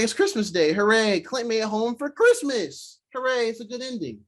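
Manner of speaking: high-pitched sing-out hooray; mock celebration. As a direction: cheer it like a storybook happy ending. Mock cheering for Christmas happy ending.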